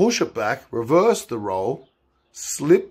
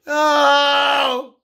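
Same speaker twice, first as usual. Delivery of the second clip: mock scream; 14 semitones higher, 5 dB louder than usual. Driver screaming off into distance.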